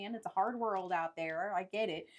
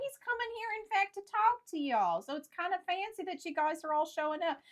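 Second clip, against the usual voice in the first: lightly voiced traveller, higher in pitch; mock traveller; 8 semitones higher, 10 dB louder than usual. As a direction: bargain with the bandit in a put-on voice. Player voices a traveller character.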